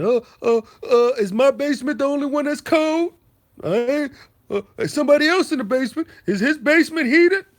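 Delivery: high, loud whiny mockery of the rival; mocking rival's complaint. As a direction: squeal it like a sore loser. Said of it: "he's always talking about".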